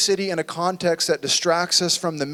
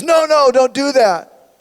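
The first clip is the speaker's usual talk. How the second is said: higher, louder, slowed desperate plea; mother crying out; 7 semitones higher, 6 dB louder, slower than usual. Retold or imitated. Mother crying out as the king raises the sword, pleading. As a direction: beg him to stop, from the gut. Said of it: "one woman cries out and says".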